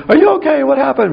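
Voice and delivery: panicked mom hearing a crash; mother shrieking up the stairs in alarm; alarmed mother's scream. Retold or imitated. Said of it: "My mother's screaming".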